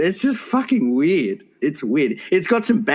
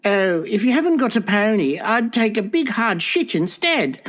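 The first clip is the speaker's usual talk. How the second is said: put-on spoiled little girl; 6 semitones higher than usual. Same spoiled child voice.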